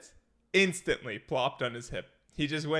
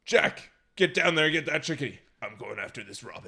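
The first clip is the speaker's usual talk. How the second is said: mock squad leader orders; 5 dB louder than usual. Eagle leader ordering Jack after the chickadee, mock commander voice. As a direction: play the bird squad leader sending Jack off.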